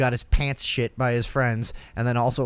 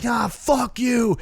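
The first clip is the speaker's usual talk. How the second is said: mock shout; 10 semitones higher, much slower than usual. Kicking the drunk friend out the door, slow high mock shout. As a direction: drag it out as you shove him out.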